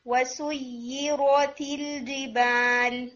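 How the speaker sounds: وسیرت with the doubled ya held long; teacher showing shadda length; slow shadda demo. Shown as a demonstration. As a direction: hold the ya in وسیرت. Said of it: "تھوڑا سا اور time دینا ہے".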